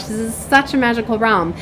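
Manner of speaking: ordinary delivery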